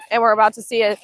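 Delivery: ordinary delivery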